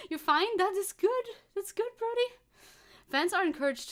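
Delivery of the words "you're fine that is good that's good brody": cooing. Real, not acted. Cooing at a viewer.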